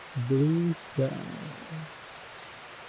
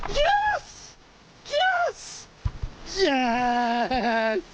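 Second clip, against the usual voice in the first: very high triumphant yells; excited cheering; 7 semitones higher, much slower than usual. Real, not acted. Fish caught, celebrating.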